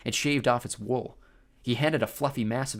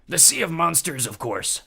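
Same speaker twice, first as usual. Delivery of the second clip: ghost captain; 6 semitones higher, 5 dB louder than usual. A narrator's reading.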